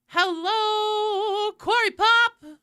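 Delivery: high, loud sing-song hellos; roll call greetings. Real, not acted. Streamer doing a Twitch roll call, high sing-song. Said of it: "let's do a Twitch roll call".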